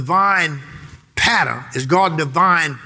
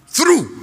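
Through shot up very high, a touch louder; stress on Through; 11 semitones higher, 3 dB louder than usual. Shown as a demonstration. Preacher to church, Through cried out high. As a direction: ring out Through. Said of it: "There that word is again".

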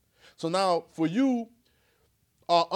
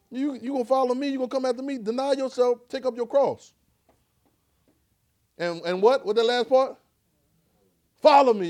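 raised voice of Jesus; 8 semitones higher, 6 dB louder than usual. Acted. Preacher voicing Jesus.

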